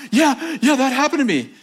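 high-pitched, freaked-out outburst; freaking out. Retold or imitated. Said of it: "she's, like, freaking out".

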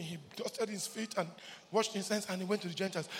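usual speaking voice

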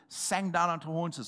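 normal talking voice

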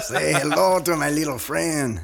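mock gangster voice; a hint of Tony Montana's Cuban accent; Scarface quote. Co-host quoting Scarface to promote beer, mock gangster voice.

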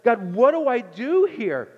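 high, loud pleading cry to God; a leader at his wits' end, yelling; mock frantic complaint to God; desperate Moses. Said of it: "he's like".

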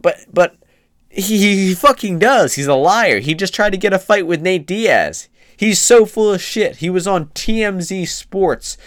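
loud, high, furious outburst; shouting in disbelief at a liar; angry rant. Real, not acted. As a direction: blast him for lying, voice rising hard. Ranting at fighter's hypocrisy.

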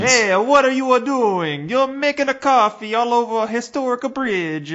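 high, loud put-on Italian police voice; cartoonish Italian officer scolding tourists; mock Italian cop.

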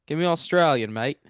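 put-on Australian accent. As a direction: toss it out in a mock Australian accent. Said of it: "Oh, that was good".

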